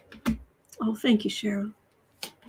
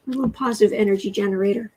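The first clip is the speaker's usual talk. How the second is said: ordinary delivery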